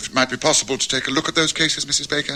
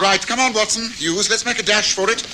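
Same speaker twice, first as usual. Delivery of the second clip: raised, higher call to hurry; rally them to dash through the rain; urging out in the rain; 8 semitones higher than usual. Acted. Dashing out into rain.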